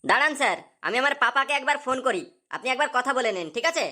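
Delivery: ordinary talk, nothing marked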